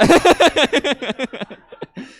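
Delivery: long high giggle; high laugh. Real, not acted. Laughing at crowd response.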